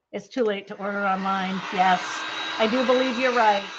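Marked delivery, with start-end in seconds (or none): none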